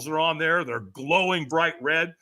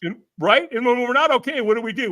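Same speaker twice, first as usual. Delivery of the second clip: ordinary delivery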